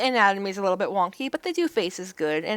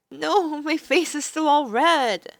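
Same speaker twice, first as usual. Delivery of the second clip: higher, louder, slowed flustered denial with a laugh; raised flustered girl voice, laughing; embarrassed heroine; 6 semitones higher, 4 dB louder, slower than usual. Acted. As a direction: laugh it off, caught out.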